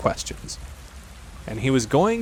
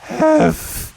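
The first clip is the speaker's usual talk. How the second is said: high, loud breathless burst; Piglet out of breath; 5 dB louder than usual. A narrator's reading. Piglet can hardly speak after scampering, breathless squeal. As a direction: squeak out half a word, winded. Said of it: "breathing so hard that he could hardly speak".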